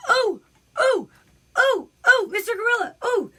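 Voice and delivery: high, loud repeated squeals; mock-startled friend. Friend being humped by the gorilla, mock squealing. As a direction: squeal in hammed-up surprise, each oh swooping down. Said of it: "And she's like".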